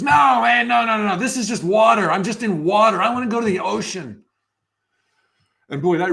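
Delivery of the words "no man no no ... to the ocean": high, loud, insistent protest; a small fish yelling that this is only water; little fish shouting. Retold or imitated. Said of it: "the little fish goes".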